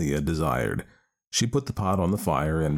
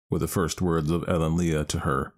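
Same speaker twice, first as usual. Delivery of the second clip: plain reading voice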